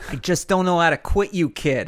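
put-on hammy Boston accent delivery. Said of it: "in a Boston accent?".

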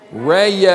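"rest" stretched long as "ray-yest"; slow Southern rest. Shown as a demonstration. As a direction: hold "rest" out over two slow syllables. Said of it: "get ready, one more time".

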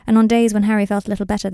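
ordinary delivery

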